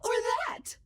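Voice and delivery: AI tries a high voice. Shown as a demonstration. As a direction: pitch way up. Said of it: "I can sound like anyone".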